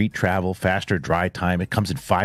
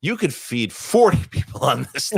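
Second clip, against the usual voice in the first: laughing; 5 semitones higher than usual. Real, not acted. Laughing at huge deal.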